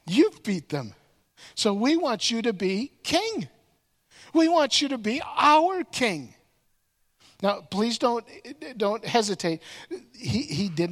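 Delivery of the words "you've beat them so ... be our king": rising louder and higher into "our king"; building plea. Retold or imitated. Tribe leaders pressing Gideon to be king, building louder. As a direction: build the crowd's demand to a peak.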